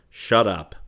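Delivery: loud, jesting brush-off; amused retort. A narrator's reading. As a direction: cut a friend off in good humour. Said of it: "laughed Trent".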